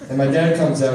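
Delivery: ordinary talk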